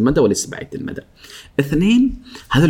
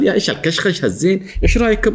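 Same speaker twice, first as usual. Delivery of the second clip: mock show-off arrival; 7 semitones higher than usual. Narrating the show-off colleague arriving, mock excited. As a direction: play up the flashy entrance.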